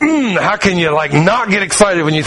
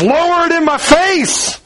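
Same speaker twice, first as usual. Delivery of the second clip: high, slow and emphatic; bold invitation; 10 semitones higher, slower than usual. Retold or imitated. God daring people to bring their burdens, high and slow. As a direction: stretch it out, inviting.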